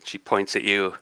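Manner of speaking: ordinary talk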